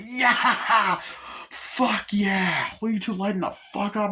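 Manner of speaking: high-pitched, hammed-up victory whoop; wild triumphant yell. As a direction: crow wildly as the crazed driver. Acting out triumph past the train.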